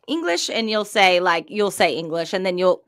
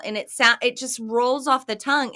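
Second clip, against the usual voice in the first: ordinary delivery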